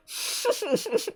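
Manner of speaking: very high, rapid giggle; helpless high snicker. Real, not acted. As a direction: burst into high-pitched tittering. Cracks up after quoting coworker.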